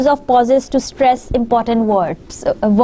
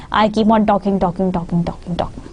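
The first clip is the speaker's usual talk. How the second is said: talking, talking fading low and soft; throwaway "talking, talking", trailing into near silence; unstressed running talk; 4 semitones lower, 4 dB quieter than usual. Shown as a demonstration. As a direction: let each repeated "talking" sink away unstressed. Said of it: "which words which are not very necessary".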